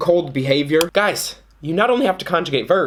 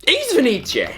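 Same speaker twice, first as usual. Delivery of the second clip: mock Russian apology; 9 semitones higher than usual.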